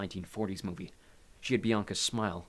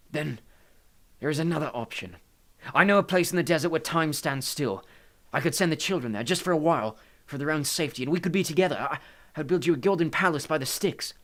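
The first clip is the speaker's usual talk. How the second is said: higher, urgent, persuading voice; pleading Hades; 6 semitones higher, 6 dB louder than usual. A narrator's reading. Hades offers Maria a refuge, pleading.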